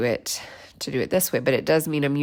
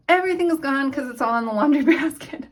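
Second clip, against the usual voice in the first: laughing it out, a bit louder and higher; 8 semitones higher, 5 dB louder, faster than usual. Real, not acted. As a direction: laugh through the words.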